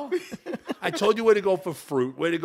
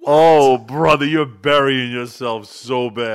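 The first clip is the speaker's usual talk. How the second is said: exasperated; 9 dB louder, slower than usual. Real, not acted. Mock dismay at rival's insult.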